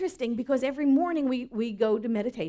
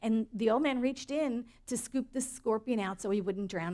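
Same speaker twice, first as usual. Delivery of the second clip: normal talking voice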